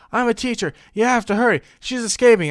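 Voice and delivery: mock pleading girl; Peyton. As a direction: imitate a girl bluffing in a hurry. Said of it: "And Peyton's like".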